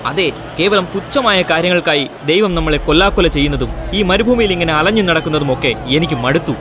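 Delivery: fed-up complaint. Acted. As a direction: complain like someone sick of wandering. Fed-up follower complaining in drama. (മടുത്തുള്ള പരാതി)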